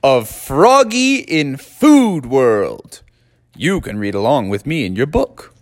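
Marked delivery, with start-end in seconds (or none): 0.0-3.0 slow, lifted title announcement; story title. A narrator's reading. Narrator opening the story, slow and bright. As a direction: announce the title to young listeners.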